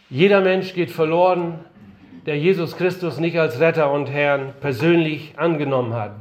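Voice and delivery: ordinary talk, nothing marked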